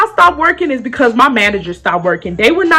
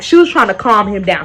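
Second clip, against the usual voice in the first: plain reading voice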